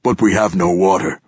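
rumbling giant. A narrator's reading. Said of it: "rumbled the giant".

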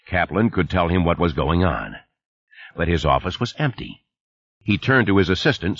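plain reading voice

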